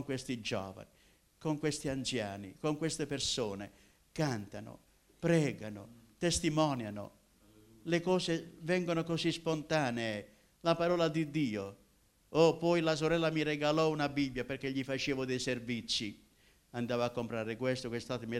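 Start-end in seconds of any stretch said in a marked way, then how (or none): none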